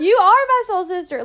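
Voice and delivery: high and loud, gushing; real delighted gushing; excited. Real, not acted. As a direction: proclaim it joyfully. Excited declaration to friend.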